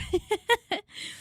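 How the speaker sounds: a high cackling laugh of relief; giddy laugh. Real, not acted. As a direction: laugh like you beat death. Laughs after I lived.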